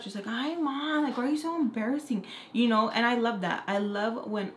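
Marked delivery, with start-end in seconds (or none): 0.3-2.3 put-on higher, quick protest of a little girl; embarrassed kid. Little girl embarrassed by her mom, higher-pitched protest.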